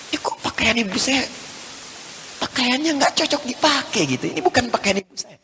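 high, soft, stunned voice; shocked disbelief. Retold or imitated. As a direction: ask it as if you can't believe your eyes. Son shocked at mother's clothes.

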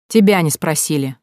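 ordinary delivery

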